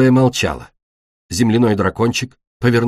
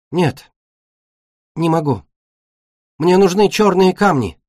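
high, halting confession; distressed refusal; 7 semitones higher than usual. Acted. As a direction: admit it in a higher, troubled voice. Беда refuses, upset. (расстроенно, высоким голосом)

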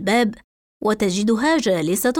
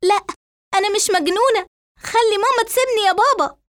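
high-pitched tearful protest; acted wailing appeal, very high; crying; 10 semitones higher, faster than usual. A narrator's reading. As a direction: plead to father through tears. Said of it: "فتبكي ريتال وتحدث أبوها قائلة".

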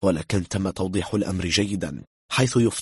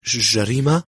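higher, louder startled stammer; alarmed stammer; 5 semitones higher, 6 dB louder than usual. A narrator's reading. German guest shocked at word of a crime, alarmed stammer. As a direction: trip on the first sound in alarm. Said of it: "شحب وجه الرجل".

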